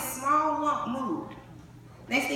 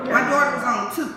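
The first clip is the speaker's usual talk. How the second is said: ordinary talk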